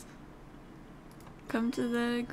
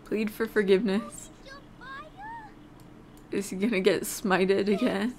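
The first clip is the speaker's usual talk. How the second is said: normal talking voice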